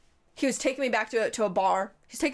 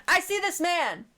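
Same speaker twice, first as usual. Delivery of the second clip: higher, dramatic I see this man; high dramatic repeat; 8 semitones higher, slower than usual. Shown as a demonstration.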